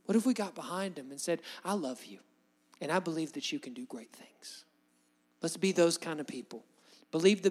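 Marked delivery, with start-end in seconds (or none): 1.6-4.6 soft, near-whispered loving words; tender encouragement. Retold or imitated. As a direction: say it gently like a hushed promise. Voicing a loving supporter.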